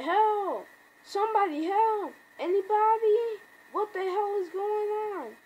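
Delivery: high-pitched cries for help; mock flipping out. Comic fan voicing Rick finding a zombie, mock panicked cries. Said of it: "He's flipping out".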